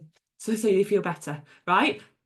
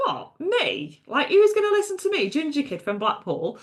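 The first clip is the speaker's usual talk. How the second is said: high-pitched, incredulous protest, quickening; retold self-doubting astonishment, pitched way up; mock disbelief; 7 semitones higher than usual. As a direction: act out a disbelieving "who, me?". Retelling her disbelief at coaching suggestion.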